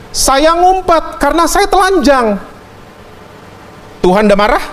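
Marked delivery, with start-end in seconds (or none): 0.1-2.5 high, slow confession; Adam answering. Acted. Adam answering God from hiding, high and slow. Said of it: "Adam jawabnya apa?".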